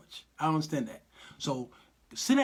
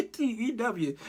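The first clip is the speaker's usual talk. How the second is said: laughing; 6 semitones higher, slower than usual. Real, not acted. Laughs at the drama.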